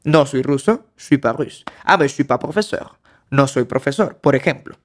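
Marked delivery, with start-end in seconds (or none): 1.0-1.6 "je suis pas russe" with "je suis" slurred into "chui"; casual "je suis pas russe", "je" swallowed; je suis blended. Shown as a demonstration. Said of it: "se junta el je con el suis". 1.8-3.0 relaxed native-style "Ah, mais je suis pas professeur"; offhand French "je suis pas professeur"; casual spoken French. Shown as a demonstration. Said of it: "Si hipotéticamente lo dijera".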